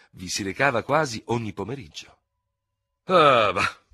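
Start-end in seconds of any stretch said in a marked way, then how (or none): 3.0-3.8 loud, scoffing dismissal; high, loud ironic scoff; ironic exclamation. A narrator's reading. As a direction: burst out with mocking disbelief. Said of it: "esclamò ironicamente Dubert".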